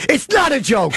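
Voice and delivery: high, hammed-up protest; mock outraged yell. Host waved off as joking, mock outrage. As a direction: yell it as if gravely offended. Insisting his yelled threat is serious.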